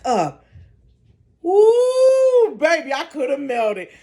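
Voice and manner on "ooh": high squealing ooh; excited ooh. Retold or imitated. Scary haunted house story.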